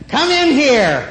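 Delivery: high, loud stern mother call. Retold or imitated. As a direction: call the kid in like an angry mom. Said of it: "I heard this loud mother voice".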